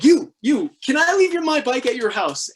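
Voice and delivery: high, loud pleading ask; eager plea. Retold or imitated. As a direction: beg a stranger eagerly. Asking strangers to store bike.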